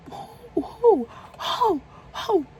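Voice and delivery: very high, insistent questioning; baffled demand. Retold or imitated. Storyteller demands the informant's name from the caller, high and incredulous.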